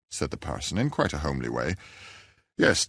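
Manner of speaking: ordinary delivery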